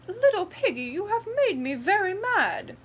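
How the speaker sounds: mother pig scolding her muddy piglet; cross mother pig. Acted. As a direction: tell off the little pig.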